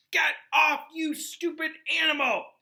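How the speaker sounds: high, loud angry yell; furious shout. Acted. Ron yelling at Crookshanks clawing his bag, furious and loud.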